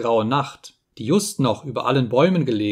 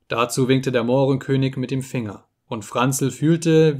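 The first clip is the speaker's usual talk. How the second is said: usual speaking voice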